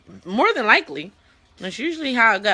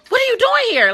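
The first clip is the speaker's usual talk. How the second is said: mock shocked question; 6 dB louder than usual. Imagined parent catching them.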